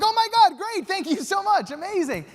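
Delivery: squealing, high and loud thrill; overjoyed. Retold or imitated. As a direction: burst with disbelieving joy. Overjoyed at yes.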